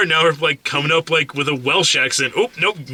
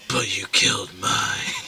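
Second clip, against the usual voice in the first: hushed voice; 8 semitones lower, 5 dB quieter than usual. Retold or imitated. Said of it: "people whispering".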